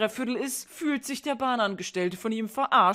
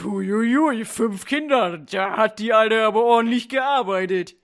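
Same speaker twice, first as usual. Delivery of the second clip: cheeky mocking voice; 8 dB louder than usual. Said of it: "wer jetzt denkt".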